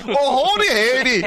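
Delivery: high, loud hammed-up twang; goofy accent greeting, laughing; mock howdy accent. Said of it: "That's always like".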